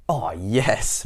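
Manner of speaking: ordinary delivery, nothing marked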